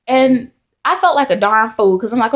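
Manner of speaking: usual speaking voice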